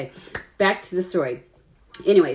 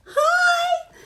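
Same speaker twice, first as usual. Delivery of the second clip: squeaky high mock greeting; a stranger's eager wave, mocked high and bright; mock cheery hi. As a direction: imitate her waving hello, way up high. Mimicking woman waving at stranger.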